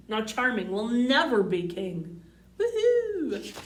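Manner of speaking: usual speaking voice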